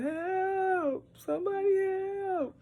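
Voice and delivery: put-on high, squeaky stretched baby wail; baby crying for help. Mother voicing baby on changing table, high squeak. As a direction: sing out the baby's tiny help call. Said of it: "And so she said".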